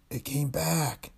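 slow hoarse whisper; creaky whisper. Acted. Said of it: "he whispered hoarsely".

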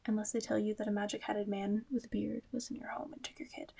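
conspiratorial whisper; hushed joking aside. Real, not acted. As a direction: drop to a whisper for the joke. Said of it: "whispered aside".

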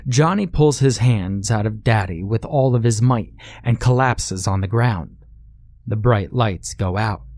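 ordinary delivery

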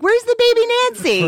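high, loud mock family yell; calling out. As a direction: call it across a crowded dinner table. Imagined family calling at dinner.

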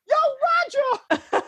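high calling-out yell; street shout. Retold or imitated. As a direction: holler his name from a car. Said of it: "fan yelling from car".

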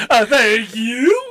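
high, drawn-out mock thank-you; mock grateful gangster. Mimics a duped gangster thanking.

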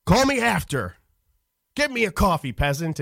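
a touch of arrogant star; mock snooty yell. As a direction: order them around like a snob. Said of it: "yelling back".

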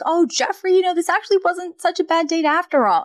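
high, gushing mock delight; charmed Fran. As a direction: swoon a little as Fran. Fran charmed after dance.

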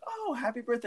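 high, sugary mock greeting; imitating next customer. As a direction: coo it like a cheerful stranger. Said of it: "the guy after was like".

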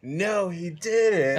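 high, drawn-out disbelieving call; balcony heckler. Retold or imitated. Comic retells the crowd's reaction to the vomiting, drawn-out mock call. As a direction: imitate someone calling out from the balcony, slow and high. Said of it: "I just hear from the balcony".

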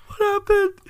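very high squeaky mock-confused kid; imitating tearful laughter. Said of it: "He's crying of laughter".

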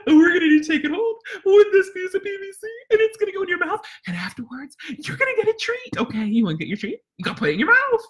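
high, loud, fast cheer to the dog; fun excited voice. Shown as a demonstration. Trainer pumping up Chance for a training game, high and fast. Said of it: "make sure it's fun".

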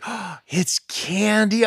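high, slow, wide-eyed discovery; grandma delighted to find the rocks are candy; grandma's amazement. Retold or imitated. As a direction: stretch it out like she can't believe it. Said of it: "And she's like".

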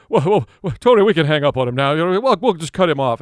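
loud, fast mock alarm; mock alarmed host. Host Ron urges Tony to hang up, loud and fast. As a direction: wave off the caller in a hurry. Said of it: "And [name]".

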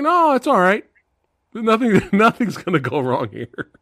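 lightly high, breezy reassurance; mock counselor. Mocking the counselor's careless reassurance, lightly high. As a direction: wave off danger, lightly mocking.

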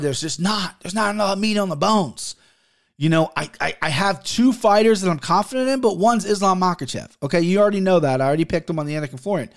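usual speaking voice

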